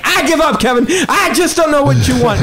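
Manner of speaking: high, exasperated George-style whine; exasperated outburst. Retold or imitated. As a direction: blurt it out like you've had enough. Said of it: "he just blurts out".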